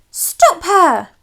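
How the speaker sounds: high, loud order to stop her; shout. Acted. Guards shouting as the Queen escapes. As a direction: yell after the fleeing Queen.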